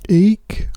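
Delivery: a high little squeak instead of a roar; tiny lion eek. Acted. Lion opens mouth to roar, only a high eek. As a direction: squeak like a scared mouse, not a lion. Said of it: "let out a".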